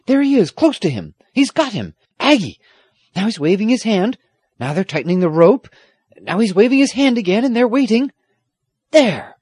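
high, loud blow-by-blow report; breathless-with-suspense commentary, pitched right up; excited. A narrator's reading. Squire reporting the rescue to granddaughter, excited. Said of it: "almost as excited as she was".

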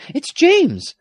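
very high, loud alarm; high cry of horrified recognition. A narrator's reading. Girl recognising James in danger, crying out. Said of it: "she cried".

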